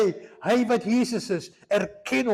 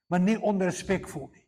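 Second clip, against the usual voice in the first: ordinary talk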